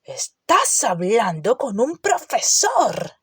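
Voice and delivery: slow, deliberate indignant roar; roaring rebuke. A narrator's reading. Hagrid scolding Harry at the door, slow indignant roar. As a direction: lean on each word to put him in his place. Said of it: "rugió".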